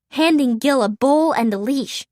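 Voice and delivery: ordinary delivery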